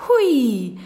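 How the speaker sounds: high, drawn-out "hui"; gleeful loud whoosh; whooshing exclamation. A narrator's reading. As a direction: swoop up like a broom taking off. Broom whooshes off into the air.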